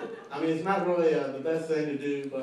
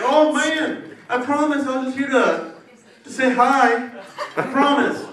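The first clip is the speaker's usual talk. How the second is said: high, loud mock begging; pleading; 9 semitones higher, 8 dB louder, slower than usual. Caught man pleading with the cops, high loud begging. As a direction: beg your way out, voice up high. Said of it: "they try to say".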